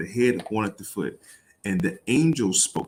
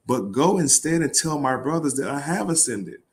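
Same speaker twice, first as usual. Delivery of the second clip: higher, pointed reading of Jesus' words; incredulous reading; 6 semitones higher than usual. Acted. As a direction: read it as if it makes no sense.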